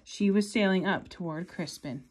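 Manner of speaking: lively read-aloud storytelling; story narration. Acted. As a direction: read it like a bedtime picture book. Reading picture book aloud.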